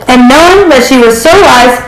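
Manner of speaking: usual speaking voice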